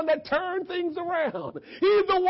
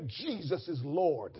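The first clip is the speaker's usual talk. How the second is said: soft declaration; 12 semitones lower, 11 dB quieter, slower than usual. Shown as a demonstration. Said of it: "I want you to say".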